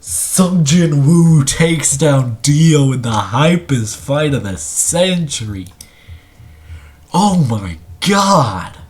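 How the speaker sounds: big drawn-out announcer call; hyped winner announcement. Real, not acted. Commentator calls Sung Jin-woo's win over Dio, hyped and drawn out. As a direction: stretch out the victory call with awe.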